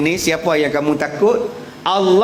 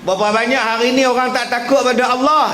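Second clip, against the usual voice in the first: fiery preaching; 8 semitones higher than usual. Acted. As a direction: preach it hard to the congregation. Preacher rising again.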